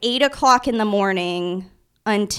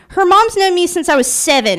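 ordinary delivery

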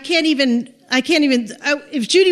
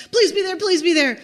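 urgent higher-pitched silent prayer; anxious pleading; 6 semitones higher than usual. Retold or imitated. Friend hoping Judy is in the house, anxious pleading. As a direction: plead under pressure, pitched up.

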